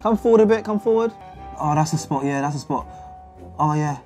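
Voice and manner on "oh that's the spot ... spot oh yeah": normal talking voice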